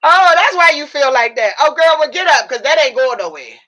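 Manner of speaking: high, loud and emphatic to herself; loud self realization. Retold or imitated. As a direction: tell yourself off to get up. Loud self-talk realizing cause.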